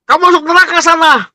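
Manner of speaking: high-pitched banishing order; acted stern condemnation. God condemns sinner in retold parable. (vonis tegas bernada tinggi)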